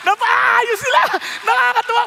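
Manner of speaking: loud, beaming exclamation of delight; joyful. Real, not acted. Preacher rejoicing that the couple reunited, loud and glad. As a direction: burst out laughing with joy.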